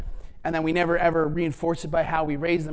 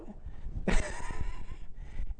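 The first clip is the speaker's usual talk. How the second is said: breathy high laugh; 8 dB quieter than usual. Real, not acted. Laughs at son's imperfection.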